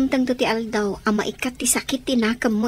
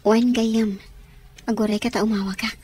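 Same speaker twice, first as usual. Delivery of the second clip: ordinary talk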